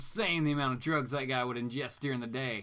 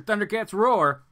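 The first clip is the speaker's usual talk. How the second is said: loud, raised exclamation; big, loud punch on the title; emphatic put-down; 6 semitones higher, 10 dB louder than usual. Real, not acted. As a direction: call out the title loudly. Jeering at a bad comic.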